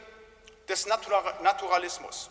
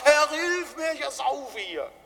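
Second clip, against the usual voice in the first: high, loud panicked cry, fading on the last words; cry for help; 7 semitones higher, 4 dB louder, slower than usual. Retold or imitated. Peter sinking in the sea crying to Jesus, high loud cry. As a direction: voice Peter calling out as he sinks. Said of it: "Und dann schreit er".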